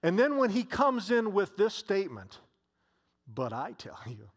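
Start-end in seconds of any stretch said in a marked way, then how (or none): 3.3-4.3 "But I tell you" dropped low and soft; a soft, lower reveal of "But I tell you"; hushed dramatic quote. Shown as a demonstration. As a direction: let the line land quietly, like a secret revealed. Jesus' surprising statement, dramatic drop.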